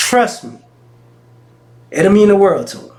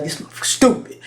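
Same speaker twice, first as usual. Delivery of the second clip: hushed aside under breath; whispered; 6 dB quieter, faster than usual. Retold or imitated. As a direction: whisper it like a secret judgment. Silent look at granddad.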